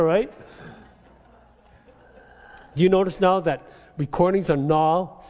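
normal talking voice

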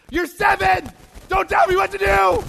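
high, very loud dad outburst; full-volume comic dad shout; exasperated dad yelling. Retold or imitated. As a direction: yell back like an indignant parent. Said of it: "And I'm like".

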